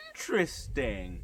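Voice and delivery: slow, drawn-out imitation of the teacher; mannered teacher's verdict. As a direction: stretch the word out as if noting it. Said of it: "She's like".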